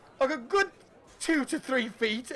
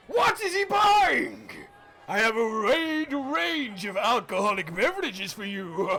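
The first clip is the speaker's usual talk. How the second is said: booming barkeep. Acted. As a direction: call out like a jolly host. Barkeep voice resumes.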